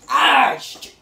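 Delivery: real exasperation at a failed fix; frustrated curse. Real, not acted. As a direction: spit out the curse as it fails. Fan part missed, swears.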